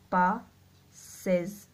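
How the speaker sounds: पासेस drawn out, the सेस ending clear; slow demo word. Shown as a demonstration. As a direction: stretch पासेस slowly to show the सेज sound. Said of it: "सेज की sound आ रही है पासेस में". (पासेस धीरे और साफ़ बोलना)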